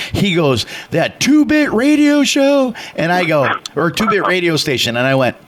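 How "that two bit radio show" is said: high, slow, incredulous mock sneer; indignant question, pitched way up; retold scornful outrage. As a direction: imitate a man spitting scorn, stretched and high. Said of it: "just going ballistic".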